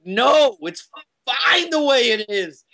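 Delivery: high, emphatic brush-off, slowing on the tail; mock dismissive. To the emailing coworker, a mock dismissive no. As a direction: wave off the request, a little put-on.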